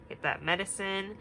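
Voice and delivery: normal talking voice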